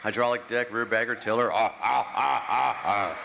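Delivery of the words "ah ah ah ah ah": aroused groans. Acted. Tractor lust.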